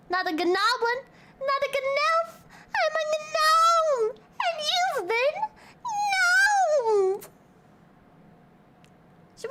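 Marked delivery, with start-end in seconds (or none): 0.1-7.3 high, squeaky drawn-out gnome; hammed-up little gnome, stretching the words. Acts out the gnome meme.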